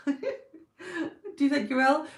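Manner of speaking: slow question through a laugh; stretched out, giggling question. Real, not acted. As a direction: ask it while laughing.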